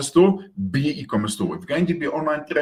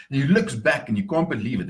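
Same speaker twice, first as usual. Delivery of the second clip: normal talking voice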